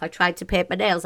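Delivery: usual speaking voice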